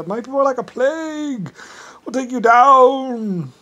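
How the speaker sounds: high voice, swelling to a loud drawn-out finish; Mister Brown's threat. Retold or imitated. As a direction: build to a long, stretched last word. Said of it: "he loved to say".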